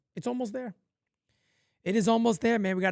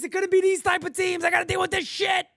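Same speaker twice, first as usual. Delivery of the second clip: fast, high, exasperated yell; frustrated shout; 8 semitones higher, faster than usual. Real, not acted. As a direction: blow up at the team's play. Ranting about the team.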